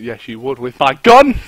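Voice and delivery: loud, slow hammed-up threat; loud, slow mock threat to a zombie. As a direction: bellow the punchline like a movie tough. Mock shopkeeper threat scene.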